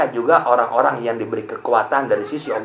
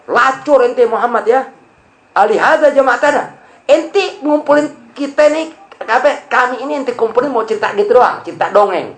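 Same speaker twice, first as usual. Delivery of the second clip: mocking Abu Lahab; 10 semitones higher, 4 dB louder than usual. Abu Lahab mocking Muhammad before the Quraysh, high and scornful. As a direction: jeer at the Prophet's story as a fairy tale.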